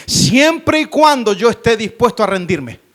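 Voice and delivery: loud, emphatic declaration; forceful preaching. Acted. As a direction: drive the condition home to the congregation.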